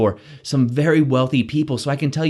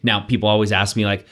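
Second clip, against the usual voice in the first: ordinary talk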